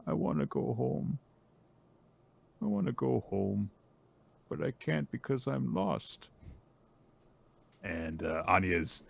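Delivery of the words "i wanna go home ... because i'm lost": put-on small, higher old-man voice; plaintive, quieter inner voice of someone lost. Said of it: "and he's just thinking".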